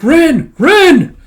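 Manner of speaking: very high, loud name calls; shouting. Acted. A friend calls after Ren while dragged off, shouting. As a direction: scream the name after someone. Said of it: "throat's getting dry from all the shouting".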